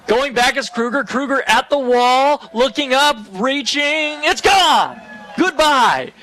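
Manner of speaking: high, building to a loud peak; real ballpark play-by-play at full excitement; excited home run call. Real, not acted. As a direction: build the suspense, then cry out the homer.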